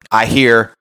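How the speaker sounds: normal talking voice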